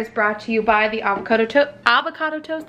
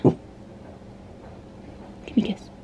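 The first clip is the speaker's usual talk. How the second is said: whispering; 2 semitones lower, 10 dB quieter, much faster than usual. Real, not acted. Soft request to the dog.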